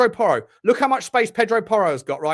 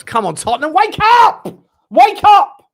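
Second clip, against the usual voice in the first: furious yell at his team, much higher and louder; shouting at the TV; 14 semitones higher, 12 dB louder than usual. Real, not acted. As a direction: scream at the players to wake up. Yelling at team on TV.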